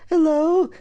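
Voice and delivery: high, loud sing-song greeting; calling out. Acted. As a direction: call through the house as mum. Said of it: "my mom calls out".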